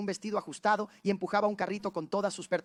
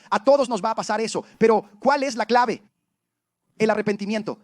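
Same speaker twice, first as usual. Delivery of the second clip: loud, fervent preaching; 2 semitones higher, 8 dB louder than usual. Acted. Pastor preaching to the church, loud and fervent. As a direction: drive the message home to the congregation.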